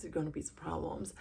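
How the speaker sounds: breathy whispered warning; hushed ominous repeat. Shown as a demonstration. Vlogger repeating her prediction to viewers, whispered. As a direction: murmur it under the breath.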